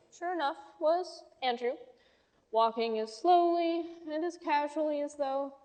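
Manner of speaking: ordinary delivery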